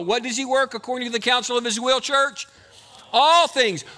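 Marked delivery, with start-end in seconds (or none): 3.1-3.8 loud emphatic answer. Real, not acted. Preacher answering his own question.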